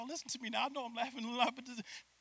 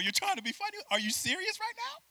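much higher, indignant outrage; hammed-up disbelief at him; mock outraged. Wife outraged at husband's remark, high mock indignation. Acted quarrel continues.

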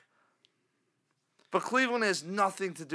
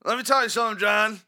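higher, louder hammed-up old fan, shouting; old diehard caller; 6 semitones higher, 6 dB louder than usual. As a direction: bellow it like a crusty season-ticket fan on the radio. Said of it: "whatever the hell this guy wants to say".

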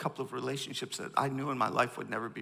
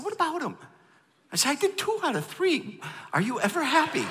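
high, loud, half-laughing protest at God; mock-indignant and loud, a laugh breaking through; exasperated complaint; 8 dB louder than usual. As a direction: whine it up high like you've had enough. Pastor retells arguing with God.

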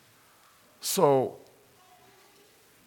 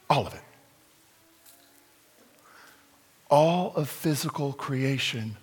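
reflective sermon; 4 dB quieter than usual. Acted. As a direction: preach it gently, like a quiet revelation. Preacher turns to scripture reflection.

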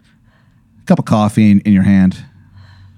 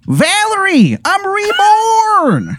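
very high, drawn-out Baltimore cry; bad Baltimore accent; much slower than usual. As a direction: proclaim it high and long in broad Baltimore. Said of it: "this Baltimore accent".

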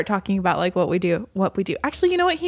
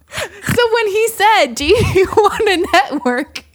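higher, louder, slow laughing telling; 10 semitones higher, 4 dB louder, slower than usual. Real, not acted. Cracking up telling her cohost the punchline. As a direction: break up laughing mid-line.

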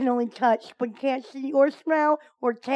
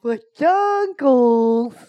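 savoring funny word; 6 dB louder, much slower than usual. Acted. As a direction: stretch Peduncles out slowly, relishing it. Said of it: "such a funny word".

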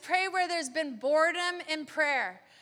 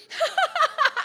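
quick high giggle; 9 semitones higher, 4 dB louder than usual. Real, not acted. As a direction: giggle with joy. Joyful laughter in prayer.

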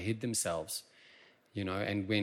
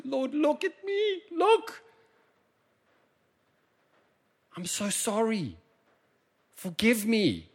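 much higher pleading cry; repentant plea; 12 semitones higher, slower than usual. Acted. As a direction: beg for forgiveness like a child.

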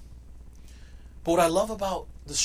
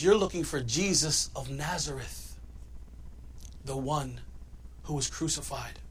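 hushed angel; 4 semitones lower, 10 dB quieter than usual. Acted. Angel's quiet words at tomb.

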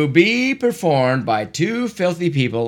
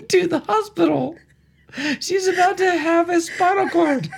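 high nervous driver voice; 14 semitones higher than usual. Acted. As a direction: squeak it out like a flustered driver. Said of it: "Do different voices".

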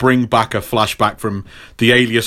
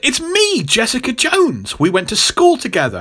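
high, insistent mock introduction; Jessica; 12 semitones higher than usual. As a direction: plead to be recognised, high-pitched. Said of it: "And he's like".

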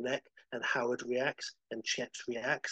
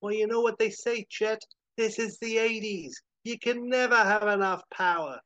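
high, raised heroic shouting; defiant shout; 9 semitones higher, 5 dB louder than usual. Acted. Howard shouts at Chet on the cusp of the rift, defiant. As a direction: shout back at the villain. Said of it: "And Howard shouts".

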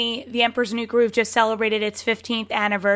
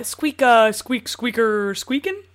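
squeaky rubber duck chatter; Bucky squirrel squeak; slower than usual. Pretend. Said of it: "so do a little squeak".

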